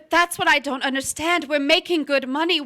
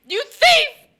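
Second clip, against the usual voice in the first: furious crying shout; 10 semitones higher, 7 dB louder, much slower than usual. Acted. Cora screaming at Nick who cheated her, furious and crying. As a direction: scream the insults, higher and louder, near tears. Wife discovers betrayal.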